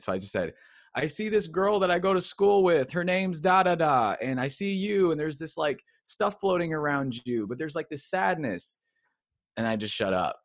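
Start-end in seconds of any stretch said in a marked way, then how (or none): 0.9-8.8 prophesying aloud. Retold or imitated. He prophesies over a kid.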